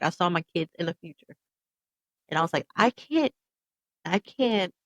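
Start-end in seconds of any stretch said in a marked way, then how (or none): 2.8-4.7 higher, louder retold plea; emphatic, pained recollection of refusing; desperate refusal. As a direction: plead it, pausing between tries. Retells pleading not to die.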